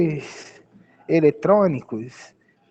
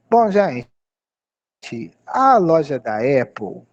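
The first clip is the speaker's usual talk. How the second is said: usual speaking voice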